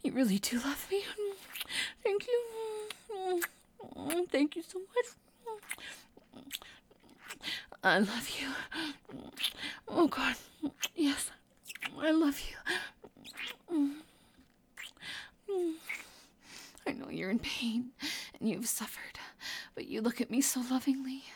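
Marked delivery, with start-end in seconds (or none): 2.0-5.1 tearful gratitude. Acted. Grateful to lover who loves her. 16.9-21.2 low, hushed, intimate murmur; tender reassurance. Acted. Speaking to an injured lover in pain, tender.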